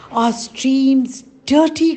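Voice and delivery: alarmed cry. A narrator's reading. Elders to the villagers, high and loud in alarm. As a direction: call out the bad news in alarm. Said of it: "cried the elders".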